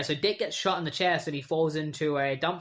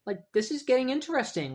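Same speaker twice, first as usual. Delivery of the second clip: intrigued; 9 semitones higher than usual. Retold or imitated. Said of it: "And so I'm like".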